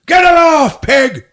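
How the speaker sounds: high, loud, furious bellow; furious shout. Retold or imitated. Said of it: "raises his fist, and just goes".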